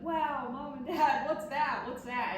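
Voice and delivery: put-on loud, slow, wide-eyed child; excited kid voice. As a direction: gush like an amazed little kid. Kids visiting the taxidermy shop.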